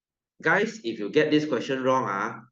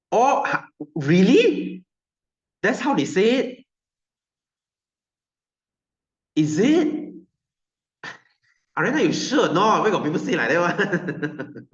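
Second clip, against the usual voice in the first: real surprise; 7 semitones higher, 5 dB louder than usual. Real, not acted. Surprised at a student's claim.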